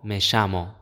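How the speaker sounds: Argentine ll in "llamo", said sh: me shamo; "llamo" with Argentine sh sound; Argentine accent. Shown as a demonstration. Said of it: "¿Escuchas esa diferencia?".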